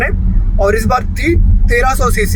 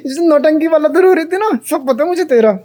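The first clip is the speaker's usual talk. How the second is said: laughing tease; 6 semitones higher than usual. Real, not acted. Teasing the baby.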